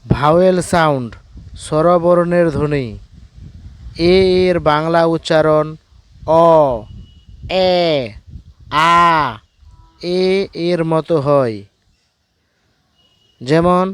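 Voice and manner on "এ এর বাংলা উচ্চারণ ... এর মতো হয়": teacher sounding out অ, এ, আ one by one; careful classroom sounding-out; sound values of the letter A. Shown as a demonstration. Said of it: "প্রতিটি বর্ণের উচ্চারণ লক্ষ্য করো".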